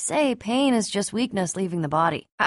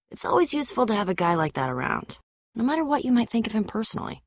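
normal talking voice

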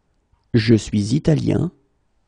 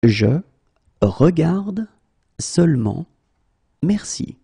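slow, word by word; 2 semitones higher than usual. Shown as a demonstration. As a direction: pause between every word. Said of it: "teacher drills word by word".

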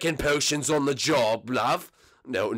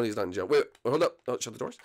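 ordinary talk

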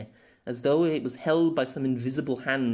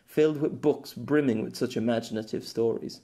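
usual speaking voice

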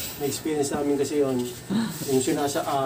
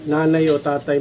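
normal talking voice

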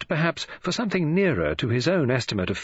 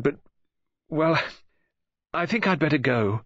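put-on higher, halting, flustered young woman; flustered girl; 5 semitones higher than usual. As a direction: stammer and break off, losing your nerve.

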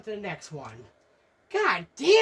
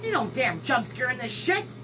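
ordinary delivery

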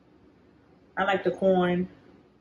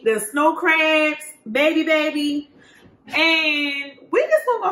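excited; 8 semitones higher, 4 dB louder, slower than usual. Real, not acted. Hyping the crabs.

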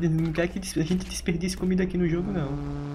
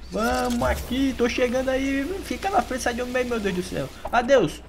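excited under fire; 6 semitones higher than usual. Real, not acted.

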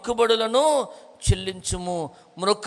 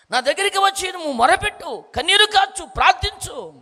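raised, higher, impassioned preaching voice; fervent preaching; 5 semitones higher, 3 dB louder than usual. Acted. Preacher urging the congregation to cry out to God, impassioned. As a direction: preach it at full force, urging them to cry out.

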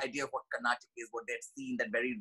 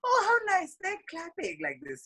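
much higher, sweetly oblivious mother; sweet mom; 3 dB louder than usual. Retold or imitated. Mom beams at the mocking crowd, high and sweet. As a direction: coo it like a proud, clueless mom. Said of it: "sweetly said".

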